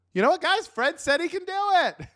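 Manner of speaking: high, bright announcing voice to the crowd; triumphant announcement. Acted. Villager telling crowd Fred agreed, high triumphant call.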